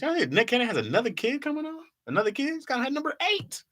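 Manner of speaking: very high, squeaky incredulous voice; hammed-up high-pitched astonishment; mock disbelief. Mock disbelief at another Cannon kid.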